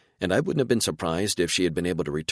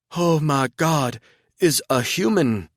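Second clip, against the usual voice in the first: higher, louder and slow, breathless shock; stunned disbelief; 10 semitones higher, 6 dB louder than usual. A narrator's reading. Woman stumbling toward another human, stunned and overwhelmed. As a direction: gasp it out as if seeing a ghost.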